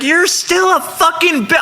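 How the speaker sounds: slow, high-pitched imitation of an irate woman; defiant insult. Cuffed granny on the curb cursing the bouncer, defiant.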